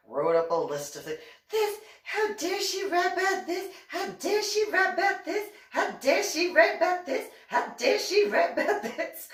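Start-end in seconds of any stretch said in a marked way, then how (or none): 1.5-9.3 high indignant mock repeat, again and again; a sneering high parody of outrage; mocking Anna. Anna listing what she wrote, high mock indignation. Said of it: "Because Anna, knowing her".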